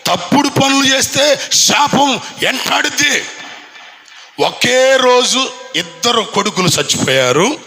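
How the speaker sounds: high, driving pulpit voice; fiery Telugu sermon delivery; full-force preaching. Acted. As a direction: preach it out to the whole congregation.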